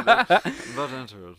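slow laughing Serling imitation; Rod Serling voice, laughing. Shown as a demonstration. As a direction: drawl it out through a laugh. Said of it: "we go and practice that".

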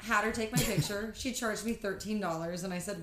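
usual speaking voice

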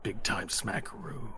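slow, soft, low private thought; quiet, drawling inner voice. Acted. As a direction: think it aloud, drawn out and hushed. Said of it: "you hear in his mind".

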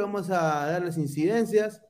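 usual speaking voice

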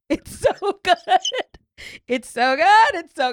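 high, loud laughing delight; overjoyed laughing praise; laughing gush. Real, not acted. Gushing after sad story.

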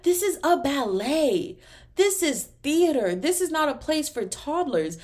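indignant. Pretend. Indignant about kids at ballet.

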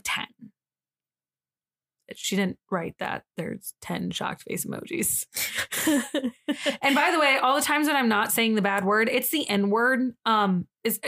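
ordinary delivery

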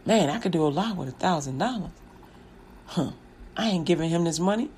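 low, soft muttering to himself; scheming aside. Acted. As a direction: mutter it privately, working out the cheat.

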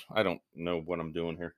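ordinary talk, nothing marked

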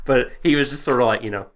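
ordinary talk

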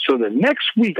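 ordinary talk

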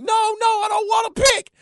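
put-on high, loud whiny child refusing; little girl wailing her refusal; upset child's shout. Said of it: "she's like".

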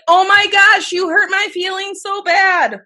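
high, loud, drawn-out fake hurt; mock hurt. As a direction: sarcastically play wounded. Mock hurt reply to insult.

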